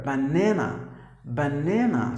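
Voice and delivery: BANANA as buh-NAN-uh; weight the middle syllable of BANANA; stress on second syllable. Shown as a demonstration. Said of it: "you have to give the stress here".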